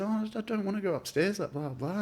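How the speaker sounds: put-on high, soft girl refusing; high, soft mock of the girl saying no; upset girl. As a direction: squeak out the girl's refusal softly.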